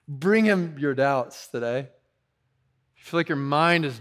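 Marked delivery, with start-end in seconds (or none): none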